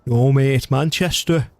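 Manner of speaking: put-on rough go at a Manchester accent; badly faked Mancunian voice; attempted Manc accent. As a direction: put on a Manc accent, not quite landing it. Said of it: "I can't do the Manc accent".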